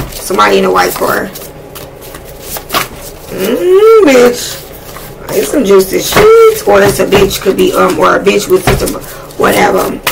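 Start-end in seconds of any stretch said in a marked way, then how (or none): 3.3-4.0 sharp rising mm; high excited hum. Real, not acted. Reacting to juicy message. 4.1-6.7 slow, high, savoring tone; relishing. Real, not acted. Relishing the gossip.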